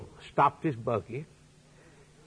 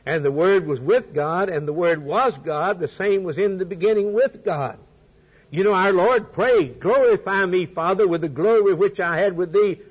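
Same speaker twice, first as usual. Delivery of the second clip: full force preaching; 5 semitones higher, 5 dB louder than usual. Acted.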